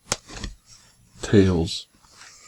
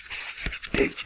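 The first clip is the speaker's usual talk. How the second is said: whispered stink; 8 dB quieter than usual. Acted. Hushed reveal about skunks.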